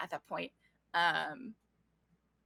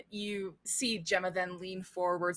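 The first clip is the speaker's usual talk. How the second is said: ordinary talk, nothing marked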